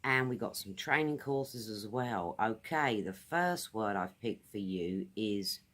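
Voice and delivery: ordinary talk, nothing marked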